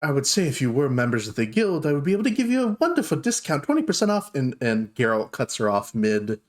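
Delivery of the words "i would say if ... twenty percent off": higher voice, speeding into the offer; obliging dwarf shopkeeper. Acted. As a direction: pitch the deal high and eager.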